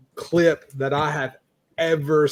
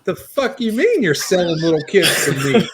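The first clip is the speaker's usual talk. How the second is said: laughing outrage; 5 semitones higher, 5 dB louder than usual. Real, not acted. Outraged at show twist, laughing.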